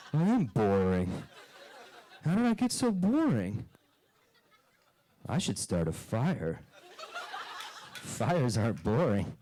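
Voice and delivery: put-on a kid's slow, wide-eyed crisis, with laughs; bewildered child voice. Kid told he's boring, in a dazed, laughing voice. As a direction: wonder aloud like a bored kid unraveling.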